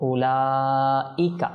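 উলাইকা slowly, "laa" held very long; four-alif elongation. Shown as a demonstration. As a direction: draw out the middle of উলাইকা before "ika". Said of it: "এটা চার আলিফ মত".

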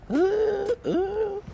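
two very high "ooh"s. Real, not acted. Player reacting to supplies, high cooing. Reacting to items found in game.

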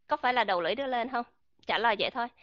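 normal talking voice

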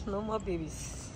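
ordinary talk, nothing marked

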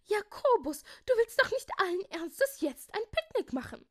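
loud, very high-pitched outrage; appalled. A narrator's reading. Rosalinde can't believe Jakobus wants a picnic, appalled. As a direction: exclaim it in shrieking disbelief. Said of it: "fragte Rosalinde entsetzt".

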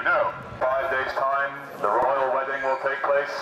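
ordinary talk

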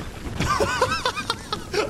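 high laugh. Real, not acted. Streamer laughing at absurd play.

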